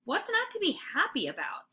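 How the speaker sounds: high, bouncy excited dog; eager puppy. A narrator's reading. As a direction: chirp it out, all wagging tail.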